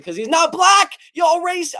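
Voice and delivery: loud, high-pitched indignant shout; mock outrage. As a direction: burst out loudly in pretend outrage. Mock outrage over a chat comment.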